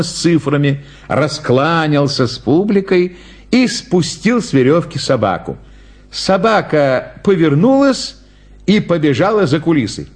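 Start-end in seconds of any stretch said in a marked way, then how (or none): none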